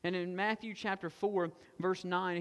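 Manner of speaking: ordinary delivery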